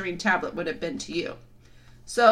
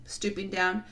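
usual speaking voice

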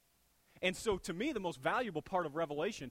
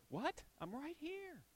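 higher, puzzled mock wife; the wife turning in the car, baffled; lightly voiced wife, puzzled; 10 semitones higher, 8 dB quieter, slower than usual. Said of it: "your wife when she's in the car".

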